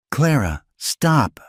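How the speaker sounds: sharp high plea to stop her; a man breaking in on her despair; Eli, urgent. Acted. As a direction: cut her off, pleading. Eli stops her outburst.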